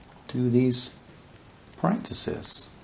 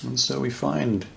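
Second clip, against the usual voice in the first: unhurried meditation voice with long pauses; guided meditation; faster than usual. Real, not acted. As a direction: guide listeners gently into awareness, leaving space between phrases.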